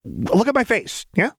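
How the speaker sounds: high, pestering character voice; manic Jinx. A narrator's reading. Jinx badgering the doctor, high and pushy.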